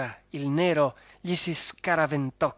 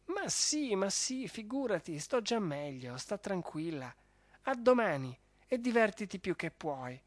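a hint of higher, softer baron, a bit stunned; dazed baron; 5 semitones higher, 5 dB quieter than usual. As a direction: thank them weakly, still groggy. Baron reassures Fulvia.

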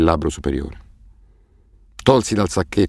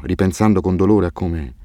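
ordinary delivery

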